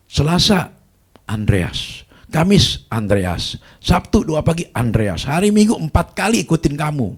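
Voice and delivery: angry ranting. Retold or imitated. Angry caller ranting at the pastor, loud and high. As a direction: spit out every day like an accusation.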